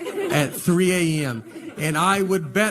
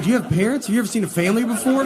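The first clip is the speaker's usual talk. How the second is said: higher, exasperated disbelief; incredulous; 7 semitones higher, faster than usual. Retold or imitated. Father mocking the school caller, incredulous and raised. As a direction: ask it like they have lost their minds.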